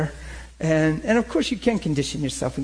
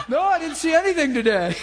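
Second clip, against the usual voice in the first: high, laughing voice, barely getting words out; laughing through a quote; 11 semitones higher than usual. Retold or imitated. Preacher laughing as he retells his hunting story. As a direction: retell it while cracking up.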